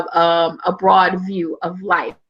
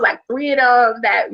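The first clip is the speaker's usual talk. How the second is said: usual speaking voice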